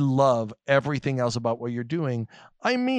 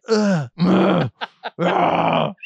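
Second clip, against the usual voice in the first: series of disgusted "ugh" noises, getting louder; exasperated groans; 2 semitones higher, 5 dB louder, much slower than usual. Acted. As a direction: groan in disgust at a clumsy word. Disgusted at the awkward word.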